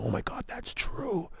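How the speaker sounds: whispered, quiet friend's aside; hushed aside. Retold or imitated. Friend whispering agreement to a buddy. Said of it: "point at their friend and go".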